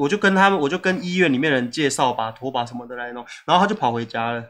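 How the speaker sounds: ordinary talk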